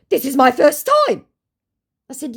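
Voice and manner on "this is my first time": high, loud put-on protest; mock scammer voice. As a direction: lie with a straight face. Scammer's quoted reply.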